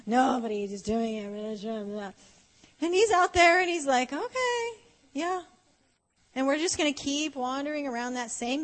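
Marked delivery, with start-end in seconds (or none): 0.1-2.1 low, slow mock whine; hammed-up grumbling, trailing lower; grumbling complaint. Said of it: "we keep grumbling, and we keep complaining". 4.1-5.4 high, drawn-out okay; lightly acted, higher-pitched humoring; humoring reply. God's reaction to the grumblers, acted.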